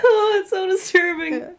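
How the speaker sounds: high, laughing, near tears; crying with laughter. Real, not acted. Laughing at the joke.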